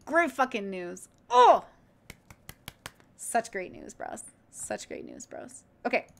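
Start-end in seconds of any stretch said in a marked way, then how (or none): 1.3-1.6 high, loud squeal of joy; sudden overjoyed "oh"; excited cry. Real, not acted. Celebrating great news from doctor. 3.2-5.6 low, soft, savouring it to yourself; quiet, low aside of deep satisfaction; hushed elation. Real, not acted. Savouring the good news, spent.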